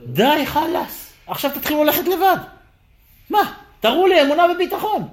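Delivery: high-pitched, insistent command; God demanding faith. Acted. God telling Israel to walk alone, insistent demand. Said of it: "מה הקדוש ברוך הוא אומר".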